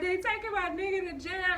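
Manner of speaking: high-pitched imitation of a tearful wail; hammed-up weepy complaint; mock crying. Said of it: "I start crying and shit".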